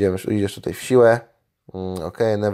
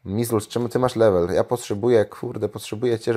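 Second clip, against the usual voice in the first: usual speaking voice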